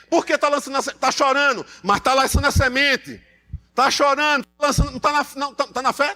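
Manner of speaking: building revival cadence; preaching. Acted. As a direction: drive the verse home to the church. Preaching on faith.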